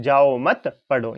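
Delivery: slow जाओ मत together, then पढ़ो apart; pause after मत. Shown as a demonstration. Teacher showing where the comma falls, pause after मत. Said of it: "यहाँ पे क्या लग रहा है".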